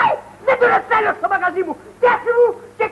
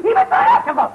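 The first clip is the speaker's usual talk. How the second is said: manic yell; 11 semitones higher than usual. Acted. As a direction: scream it out half laughing, much higher. Barber yelling at customer.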